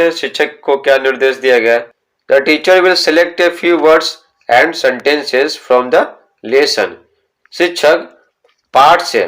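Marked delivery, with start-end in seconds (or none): 2.3-6.9 very slow, word by word instruction read; slow textbook reading. Shown as a demonstration. Teacher reading the book's instruction to students, very slow. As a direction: spell out the instruction slowly for pupils.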